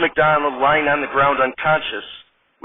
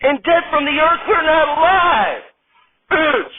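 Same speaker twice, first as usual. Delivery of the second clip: announcer voice; 11 semitones higher than usual. Acted. Announcer opening the dead-cast sketch show, booming. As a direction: open the show like a late-night announcer.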